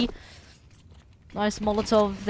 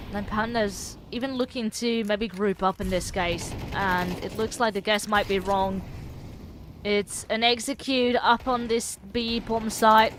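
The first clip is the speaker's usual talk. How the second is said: ordinary talk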